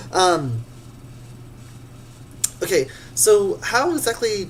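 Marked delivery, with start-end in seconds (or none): none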